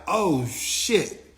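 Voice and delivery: retold shock at the fight starting; shocked oh shit. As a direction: caught off guard as fists fly. Reacts as fight breaks out.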